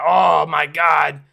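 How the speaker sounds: slow, loud mock exclamation; loud slow copy of the soundboard; drawn-out oh my god. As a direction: stretch it out like the sound clip. Echoing the Oh my God clip.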